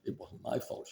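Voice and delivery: put-on whispered childish denial; sulky child. Wife denying blame like a child, whispered. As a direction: mutter it under your breath like a sulking kid. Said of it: "anything blaming other people".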